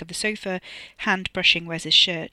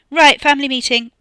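loud, raised announcement; announcing; 8 semitones higher, 12 dB louder than usual. A narrator's reading. Katie calls a family meeting, announcing loudly. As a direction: call the room to order, high and loud. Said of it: "Katie announced".

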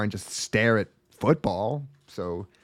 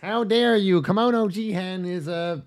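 sarcastic mock praise; 7 semitones higher than usual.

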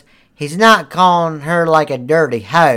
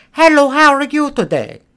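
high, loud imitation Indian accent; mock Indian accent; 11 semitones higher, 5 dB louder than usual. Retelling a manager's phone greeting, mock Indian accent. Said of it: "like, their accent".

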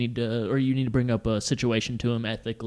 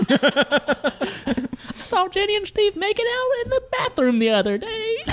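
high cartoon voice; slower than usual. Continues cartoon voice bit.

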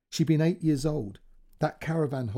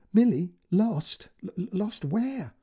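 shocked; 7 semitones higher, 3 dB louder, slower than usual. Acted. Panicked question on phone.

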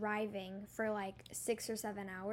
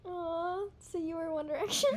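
laughing; 6 semitones higher than usual. Real, not acted. Teasing about the costume.

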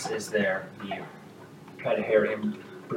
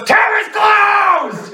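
much higher, louder, slow bellow of tavern's closed; shouting; 10 dB louder, much slower than usual. Acted. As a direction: roar it to clear the room. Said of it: "And she goes".

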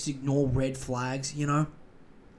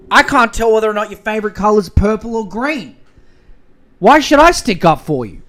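mock exasperated yell; 5 semitones higher, 12 dB louder, faster than usual. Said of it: "the guy's just standing there being like".

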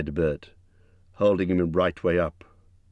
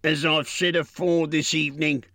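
troll quarrel; 10 semitones higher than usual. A narrator's reading. Bert goes on.

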